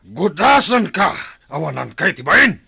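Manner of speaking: furious shout at a shameless foe; angry threat. Acted. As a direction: spit the threat at your enemy. Captive threatens chief.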